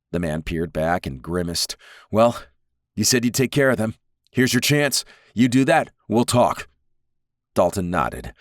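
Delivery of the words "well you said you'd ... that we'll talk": higher, pushy, nervous bargaining; voiced Wildborn daring him, a bit higher; nervy Wildborn. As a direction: toss the challenge back, edgy and higher.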